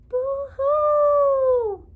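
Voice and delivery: echoed boo-hoo. Acted. Echo repeats sob.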